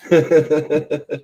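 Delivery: high giggling laugh; amused high-pitched chuckle. Real, not acted. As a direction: laugh in quick high pulses. Laughs at own story.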